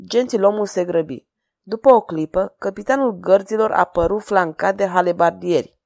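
ordinary delivery

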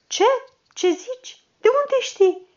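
tot mai sus, neîncrezător și uluit; nu-i vine să creadă vestea; uimire. Acted. Căpitanul aude vestea căderii Plevnei. (uimire neîncrezătoare)

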